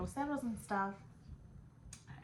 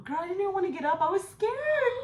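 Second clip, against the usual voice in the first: excited insistence; 11 semitones higher, 11 dB louder than usual. Real, not acted. Telling a friend she was too scared to move, loud and high.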